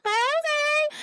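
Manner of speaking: squeaky drawn-out okay; a surprised high okay at her own life; high mock okay. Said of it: "I'm like".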